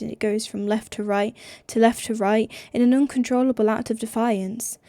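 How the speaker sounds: plain reading voice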